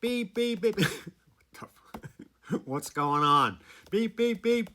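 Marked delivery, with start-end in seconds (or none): 0.0-0.8 high, short beeps like a car horn; imitated car horn. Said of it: "I hear this beeping". 3.9-4.7 high, short beeps like a car horn; imitated car horn. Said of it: "I hear this beeping".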